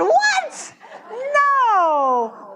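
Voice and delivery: very high, loud shocked cry; shocked disbelief. Retold or imitated. Hearing the champagne glass story, shocked high squeal. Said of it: "I said".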